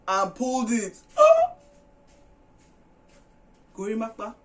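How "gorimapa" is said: shocked gasp. Real, not acted. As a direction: gasp like you can't believe it. Shock at the hair pull.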